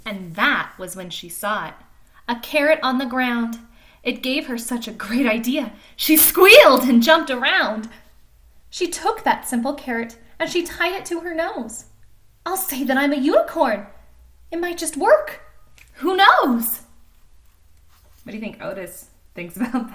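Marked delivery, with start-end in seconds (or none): 0.1-11.8 lively storybook reading voice; animated picture-book narration; reading to children. A narrator's reading. Reads picture book aloud. 12.4-16.8 higher and louder, rising with glee; excited scheming. Acted. Thelma's excited plan.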